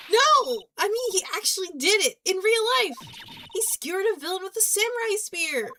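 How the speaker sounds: high, loud, urgent; girl shouting the shocking news at her boss; frantic girl. Acted. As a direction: insist desperately. Maya frantic explaining.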